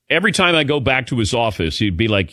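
usual speaking voice

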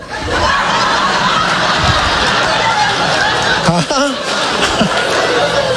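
unvoiced wheezing laugh; breathless laugh. Real, not acted. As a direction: laugh silently at the joke. Laughing at own bit.

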